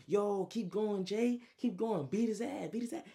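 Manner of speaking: high, loud urging in Trey's voice; egging him on. Retold or imitated. Trey urging his friend during the beating, egging him on. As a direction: egg a fighter on, high-pitched and insistent. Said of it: "while Trey egging him on".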